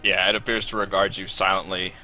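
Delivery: ordinary talk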